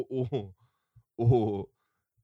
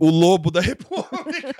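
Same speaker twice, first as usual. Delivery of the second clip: laughing; 9 semitones higher than usual. Real, not acted. Laughing repeat of nickname.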